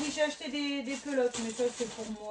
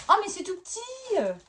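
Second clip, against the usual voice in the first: high pitched disbelief at the size; dismayed surprise; 10 semitones higher, 6 dB louder than usual. Real, not acted. Showing viewers a tiny holder, high dismayed surprise. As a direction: shoot the voice up, let down and amazed. Shows item close to camera.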